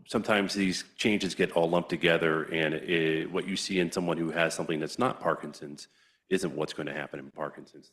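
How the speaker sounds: ordinary talk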